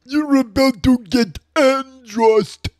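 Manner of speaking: loud, high villain taunts; booming villain. Acted. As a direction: boom it like a game boss.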